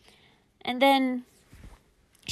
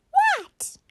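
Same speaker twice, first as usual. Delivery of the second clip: very high-pitched squeaky squeal; squeaky mummy voice; 6 dB louder than usual. Acted. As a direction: squeak it out like a tiny toy. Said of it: "the voice was so squeaky".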